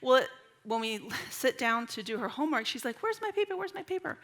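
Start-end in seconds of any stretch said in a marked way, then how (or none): 3.0-4.2 put-on much higher little-girl voice; imitated anxious child. Daughter asking mom for her missing homework, imitated child's fretting. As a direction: mimic a child in a high, fretful voice.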